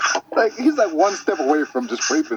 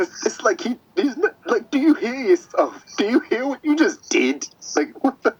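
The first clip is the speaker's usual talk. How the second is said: high, raised disbelieving questions; incredulous disbelief; 10 semitones higher than usual. Real, not acted. Reacting to the villain's confession, stunned and high.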